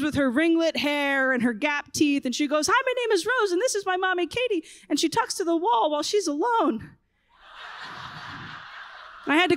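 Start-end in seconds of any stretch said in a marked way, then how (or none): 2.7-6.9 put-on high, chirpy preschool girl introducing mommy; little girl voice. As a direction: imitate a small girl cheerfully presenting her mom. Daughter introduces her mom.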